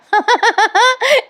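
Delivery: high rising laughter; real laugh. Real, not acted. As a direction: laugh at the kiss joke. Narrator laughs at the joke.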